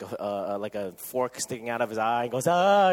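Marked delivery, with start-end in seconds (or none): 2.5-2.9 sudden loud yelp; mock yelp of pain. Kid with a fork in his eye, loud yelp. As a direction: yelp like a kid just hurt.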